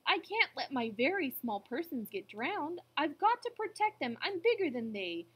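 loud, high protective resolve; worried elephant. A narrator's reading. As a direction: insist you must protect them. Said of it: "the elephant frowned".

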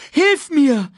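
high, loud cub's scream. A narrator's reading. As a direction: scream for help. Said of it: "schrie Simba". (Simba schreit laut und hoch)

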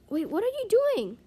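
high, loud, baffled outburst; startled disbelief. Real, not acted. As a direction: blurt it at a baffling teammate. Teammate acts unexpectedly.

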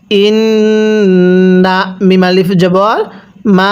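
মা held very long on its vowel; ইন hummed, মা stretched long; three-alif stretch. Shown as a demonstration. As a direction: spell it out, then draw মা out for three beats. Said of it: "তিন আলিফ টান দিব".

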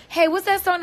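normal talking voice

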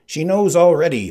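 high, loud, smug Sicilian; Sicilian's voice. Acted. Sicilian telling the Spaniard, high and smug.

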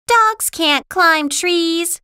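high, loud, slow objection; scornful Lucy. Acted.

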